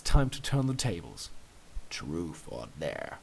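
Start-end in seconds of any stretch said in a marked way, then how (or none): 1.9-3.2 sly challenge. Acted. Turning the tables, low and slow.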